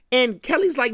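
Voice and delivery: usual speaking voice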